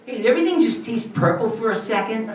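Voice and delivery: high, boyish cartoon voice; youthful cartoon teen; adolescent voice. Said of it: "kind of an adolescent voice".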